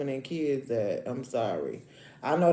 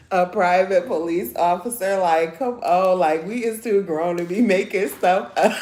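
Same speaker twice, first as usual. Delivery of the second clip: laughing; 2 semitones higher, 7 dB louder than usual. Real, not acted. Scolding the fake officer.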